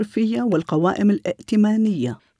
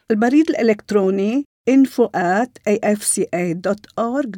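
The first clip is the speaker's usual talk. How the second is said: slow dictation; slower than usual. Real, not acted. Dictating contact details slowly.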